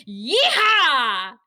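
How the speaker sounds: loud, whooping cowboy yell; convincing cowboy yeehaw. Retold or imitated. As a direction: let out a full-throated, confident yeehaw. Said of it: "as convincingly as Andy".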